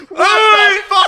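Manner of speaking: slow, very high hammed-up scream; furious yelling. Imitating David after he hit the car, hammed-up furious scream. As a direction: yell in mock outrage at the top of the voice. Retold angry driver after crash.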